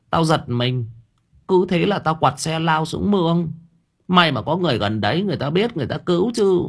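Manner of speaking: high-pitched landlady telling of her fall; landlady, shaken. A narrator's reading. Landlady recounts her accident.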